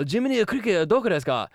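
put-on high Japanese-accented English. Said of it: "speak with what we would consider as a very racist accent".

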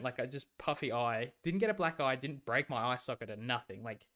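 usual speaking voice

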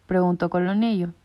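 ordinary delivery

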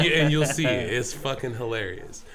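ordinary talk, nothing marked

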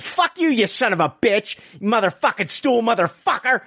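angry rant. Acted. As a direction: curse him out, worked up. Rants at the man on stool.

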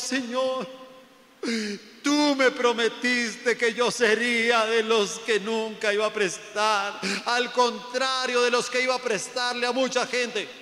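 loud, high pleading to God; mock a believer's wailing prayer; kneeling complainer. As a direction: plead loudly, drawing the words out. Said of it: "los ve uno hasta arrodillados".

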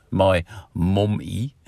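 slow, exaggerated American "mommy"; hammed-up American pronunciation of "mommy"; American accent. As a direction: draw out "mommy" in mock American. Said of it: "sounds weird the way they say that".

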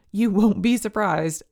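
ordinary talk

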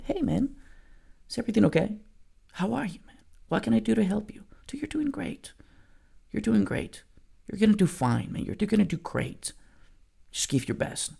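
put-on gently reassuring a struggling child on the bench; soft gentle coaching. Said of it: "I had to, like, really slow down my voice".

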